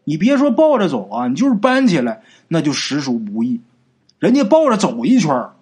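usual speaking voice

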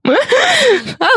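squealing laugh up high; high-pitched laugh. Real, not acted. Laughing at Krycek joke.

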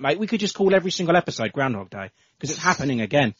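ordinary talk, nothing marked

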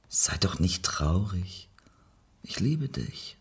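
slow soft whisper; soothing whisper to the crying wife; tender whisper. Acted. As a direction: whisper comfort close to her ear. Whispered comfort.

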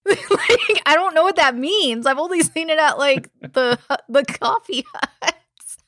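high-pitched, laughing through the words. Real, not acted. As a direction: crack up while admitting cluelessness. Laughing at her own ignorance.

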